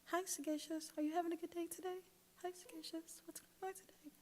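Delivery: imitating her quiet office mate, high and very soft; breathy little high voice, barely there. Office mate greeting her softly every day. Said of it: "she would always talk like this".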